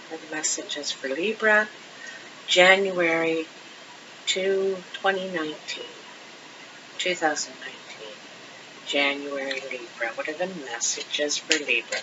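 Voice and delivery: soft, hushed murmur to the cards; murmured invocation. Real, not acted. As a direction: mutter it under your breath like a little ritual.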